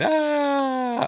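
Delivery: high imitated cry of someone falling; mock scream. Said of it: "like, going".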